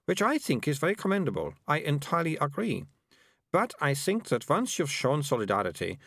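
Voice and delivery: put-on a bossy girl explaining things; prim girl. Melissa chatters on.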